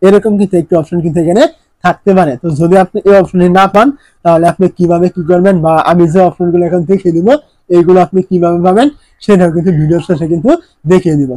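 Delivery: ordinary talk, nothing marked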